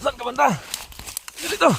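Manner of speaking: high urgent shout across the field; calling out. Real, not acted. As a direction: yell the name to find him. Searching for wounded teammate.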